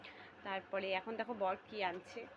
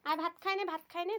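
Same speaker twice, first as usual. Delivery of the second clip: calling out; 8 semitones higher, 7 dB louder than usual. Real, not acted. Calling to the child to eat.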